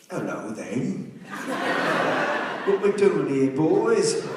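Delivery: a cocky cockney character bursting in; cockney accent. Retold or imitated. Actor at an audition bursts in with a cocky cockney accent. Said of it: "decent cockney".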